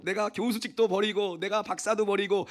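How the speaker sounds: high, slow, puffed-up preacher voice; mock pompous testimony. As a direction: ham up a self-important convert.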